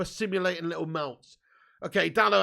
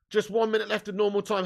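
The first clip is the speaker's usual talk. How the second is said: usual speaking voice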